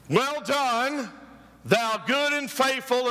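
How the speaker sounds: hint of a grand divine voice; voice of God's welcome. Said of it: "this is what you're going to hear".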